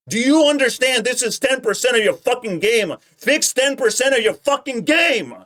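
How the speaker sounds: high, loud hammed-up fury; mock tirade at full volume; mock pissed-off shouting. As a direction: yell it like an outraged impersonation, climbing to the end. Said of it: "I'm so pissed right now".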